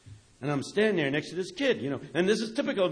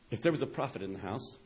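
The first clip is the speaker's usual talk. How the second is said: hushed quote; 7 semitones lower, 7 dB quieter than usual. Retold or imitated. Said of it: "says Walter Brueggemann".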